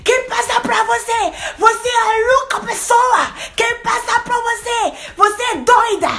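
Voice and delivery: high, loud furious shout; shrill outraged yelling; yelling at him. Retold or imitated. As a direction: scream at him in broken Portuguese. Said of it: "Commencé à crier".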